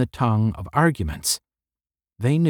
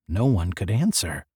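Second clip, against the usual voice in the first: plain reading voice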